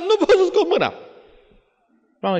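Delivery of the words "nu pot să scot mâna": put-on high, loud wail of a stuck child; screaming child, much higher and louder; child's scream. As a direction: cry out like a panicked little boy. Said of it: "Și țipă".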